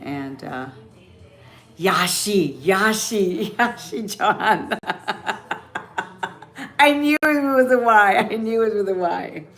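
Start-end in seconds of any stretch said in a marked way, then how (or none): 1.8-6.8 loud name-call breaking into laughter; delighted laughter. Real, not acted. Delightedly calling out Yashi John, laughing. 6.8-9.4 high, loud gleeful outburst that eases down; delighted exclamation. Real, not acted. Reacting to the name's spelling, gleefully exclaiming. Delighted after laughing fit.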